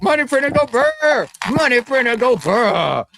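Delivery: high, hammed-up money printer cry; Jerome Powell. Acting the Fed chair printing money, high mock-triumphant cry. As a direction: chant it high, dragging out the burr. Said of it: "He's gonna say".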